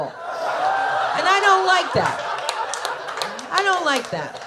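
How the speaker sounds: hammed-up talking with a full mouth. Lady complaining mid-favor, mock mouth-full protest. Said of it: "not to talk with my mouth full".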